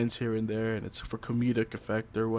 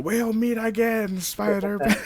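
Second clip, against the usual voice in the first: slow, higher cartoon villain line; cheesy villain; 8 semitones higher, slower than usual. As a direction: ham up the parting threat. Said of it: "Goblin says the cheesy line".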